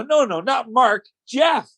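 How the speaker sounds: high, slow, as to a foreigner; emphatic correction. Retold or imitated. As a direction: spell it out for someone who isn't getting it. Slowly corrects man who misunderstands him.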